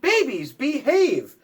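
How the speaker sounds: high, loud yell; yelling at babies. Acted. Parent yelling at babies in the cave, high loud call. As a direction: call the babies to order from afar. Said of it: "You yelled".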